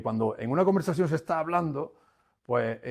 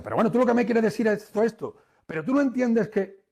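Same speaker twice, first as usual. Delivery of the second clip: higher, louder and fast, arguing; heated rebuttal; 7 semitones higher, 3 dB louder than usual. Shown as a demonstration. Trainer shows the audience a heated wrong reflection, heated rebuttal. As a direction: fire back while worked up. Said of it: "no, no".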